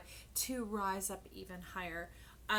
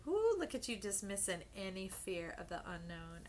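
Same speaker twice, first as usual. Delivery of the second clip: normal talking voice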